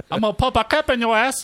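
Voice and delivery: high, loud mock gangster threat; mock thug voice. Friend mocking a demo reel with a thug voice. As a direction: ham up a tough street thug.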